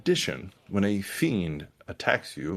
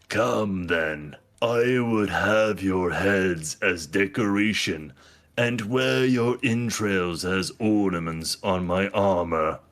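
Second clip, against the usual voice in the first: slow, thunderous demon threat; demonic voice; 4 dB louder than usual. Acted. Demon threatening the party through the catacombs, thunderous demonic voice. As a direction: boom it out slowly like a demon. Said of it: "A deep, thunderous, demonic voice that said".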